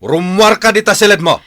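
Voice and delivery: yelled command, high and loud; furious shout. Acted. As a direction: order him out, shouting in anger. Father orders son out of room. (sipupungtot a pukkaw a bilin)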